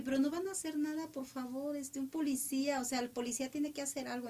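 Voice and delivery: urgent plea. Retold or imitated. Woman urging restaurant diners to call the police, high fast plea. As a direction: push the bystanders to do something. She begs diners to act.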